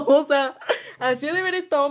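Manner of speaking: high, loud burst of laughter through the words; laughing. Real, not acted. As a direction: laugh it out, can't hold it in. Laughing at her own snore story.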